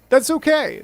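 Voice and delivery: high, loud, bright-faced denial; mock reassurance. Real, not acted. Gamer shrugs off the dying queen with forced cheer. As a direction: brush it off loudly with a forced smile.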